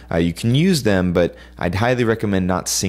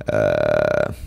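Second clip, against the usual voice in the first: very low creaky grunt; a deep rattling fry sound; vocal fry creak. Shown as a demonstration. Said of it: "hear me make this sound".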